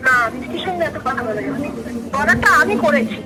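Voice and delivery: ordinary delivery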